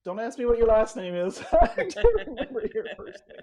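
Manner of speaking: loud, laughing tease; teacher laughing as she admits she forgot his surname; laughing joke. Retold or imitated. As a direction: laugh through the line playfully. Joking to former student, laughing.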